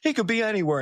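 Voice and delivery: high, loud mock alarm; mock Vernon panic. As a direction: ham up a fretful uncle. Voicing panicky Uncle Vernon.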